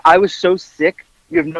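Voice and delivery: ordinary talk, nothing marked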